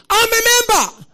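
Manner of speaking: high, full-force scream; very loud defiant yell. Retold or imitated. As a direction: scream it out to drive the intruder away. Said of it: "I screamed".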